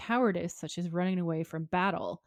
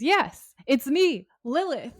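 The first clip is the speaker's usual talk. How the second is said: put-on high, loud, singsong little girl; mock sweet girlish reveal; little girl Lilith; 7 semitones higher, 8 dB louder, slower than usual. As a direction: act a gleeful child demon, pitched way up. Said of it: "a little girl appears from nowhere".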